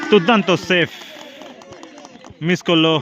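sudden excited commentator call; excited shout. Real, not acted. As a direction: burst out over the save. Commentator calls a great save.

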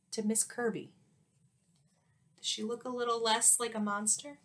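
plain reading voice

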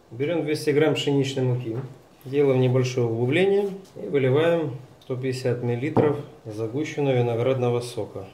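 usual speaking voice

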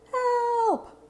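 sharp high yell of Help!; shout for help. Acted. A stranded person calling for help. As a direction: call out desperately for rescue. Cry for help in rescue scene.

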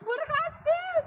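high excited cry of success; excited squeal. Real, not acted. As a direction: squeal it, thrilled. Finally picks the orange. (teriak senang)

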